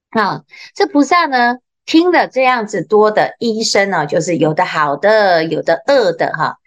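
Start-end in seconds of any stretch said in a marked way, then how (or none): none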